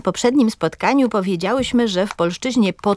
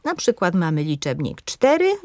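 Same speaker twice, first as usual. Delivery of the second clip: ordinary talk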